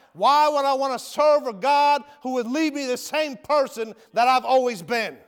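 usual speaking voice

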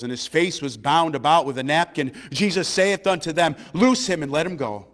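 ordinary talk, nothing marked

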